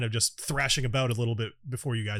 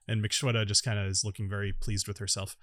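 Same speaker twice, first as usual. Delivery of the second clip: ordinary delivery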